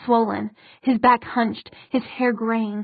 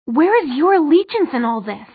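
higher, louder challenge; demanding question; 4 semitones higher, 6 dB louder than usual. A narrator's reading. Isabel questioning the queen's allegiance, demanding. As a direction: press forcefully with swooping emphasis. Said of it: "demanded Isabel".

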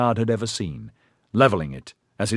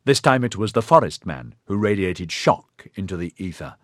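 plain reading voice

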